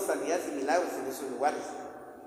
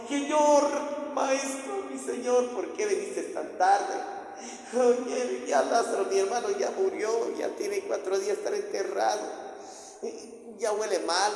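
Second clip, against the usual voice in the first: María's lament; 5 semitones higher than usual. Retold or imitated. María to Jesus about dead Lázaro, acted lament. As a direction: plead in an acted mourner's lament. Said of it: "aparece María igual lo mismo".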